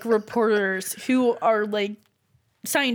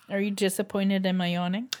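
ordinary talk

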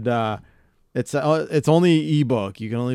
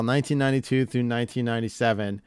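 ordinary talk

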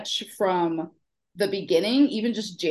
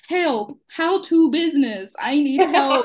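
mock desperate plea; 5 semitones higher, 4 dB louder, slower than usual. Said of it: "my P T P questionnaire was like".

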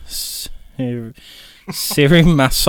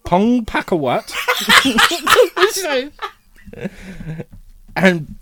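laughing; 12 semitones higher than usual. Real, not acted. Reading name through laughter.

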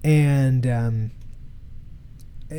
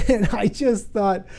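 chuckling into the words, higher; tickled, laughing lead-in; amused chuckle; 7 semitones higher, slower than usual. Real, not acted. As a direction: laugh lightly while starting to speak. Amused at his son's answer.

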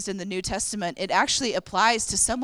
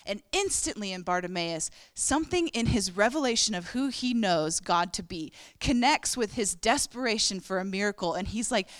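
ordinary talk, nothing marked

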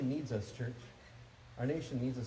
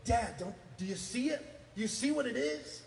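lightly voiced kids, higher pitch; excited young sons; 8 semitones higher than usual. Sons showing Dad what they made, lightly imitated excited kids. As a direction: imitate an eager child wanting Dad to look. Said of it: "and, and they go".

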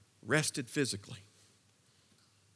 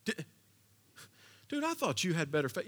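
a lightly voiced disappointed God; mock scolding God; 8 semitones higher than usual. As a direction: play a belittling, exasperated boss. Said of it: "He didn't tap him on the shoulder and said".

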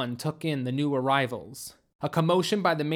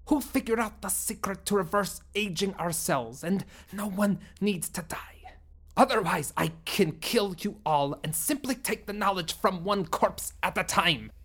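lightly voiced alien leader; 4 semitones higher than usual. Sumblok demanding the hidden runaways, a hint of menace. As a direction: threaten the hidden humans coldly.

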